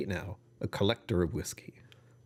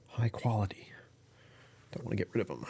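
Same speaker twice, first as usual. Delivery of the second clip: a hushed, admiring whisper; breathy mock-fancy aside; 5 dB quieter than usual. Mock fancy aside about napkins.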